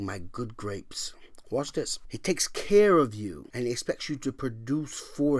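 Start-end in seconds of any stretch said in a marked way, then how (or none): 0.0-1.1 whisper. Acted. Tender divine voice whispered.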